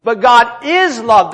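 slow phrase with "is" stretched; stress on IS. Shown as a demonstration. Preacher correcting "God is loving", stressing "is". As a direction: make "is" the weighty word. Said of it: "look at that next word".

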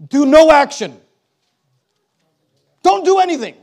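preacher mocking a misreading as a loud order; mock stern command. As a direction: ham up the wrong reading. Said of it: "should the emphasis be placed on".

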